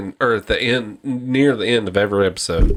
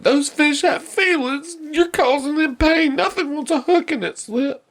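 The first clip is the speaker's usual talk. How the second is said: high-pitched mock female scold; scolding activist. Woman confronting a man fishing, scolding activist. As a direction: imitate an indignant woman, pitched way up.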